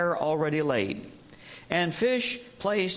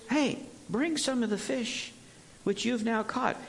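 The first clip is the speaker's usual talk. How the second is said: kind, gentle tone; 8 semitones higher, 3 dB quieter than usual. Acted. Jesus calls the tired disciples to bring fish, gentle and kind. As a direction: invite them gently, no scolding. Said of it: "do you hear the tone of voice?".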